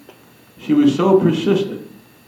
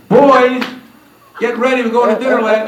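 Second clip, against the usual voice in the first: loud, high call, then quicker; calling the boys; 7 semitones higher, 4 dB louder than usual. Retold or imitated. Dad announcing dinner to the boys, loud call. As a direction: holler to the kids to get ready.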